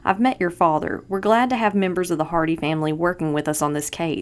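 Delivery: plain reading voice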